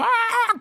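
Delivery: very high imitation chicken squawk. Shown as a demonstration. Actor told to be an animal, squawking like a chicken. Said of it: "started doing a chicken".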